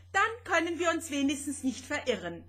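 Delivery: high, quick, relieved remark. A narrator's reading. Rosetta judging the small planet, relieved. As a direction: say it quickly, with pitch lifted in relief. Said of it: "stellte sie erleichtert fest".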